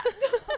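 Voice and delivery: a sudden high-pitched laugh. Real, not acted. Host bursts out laughing.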